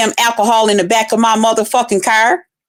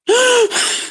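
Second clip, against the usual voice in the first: mock breathless gasp; 11 semitones higher than usual. Mocking how she catches her breath between words, exaggerated gasp. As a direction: imitate someone fighting for breath. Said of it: "trying to catch your breath".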